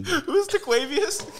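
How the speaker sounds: laughing. Real, not acted. Laughing question.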